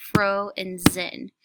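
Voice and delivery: fro and zen said slowly as separate parts; syllables split apart. Shown as a demonstration. Teacher showing students the two parts, slow. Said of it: "So now I have two parts".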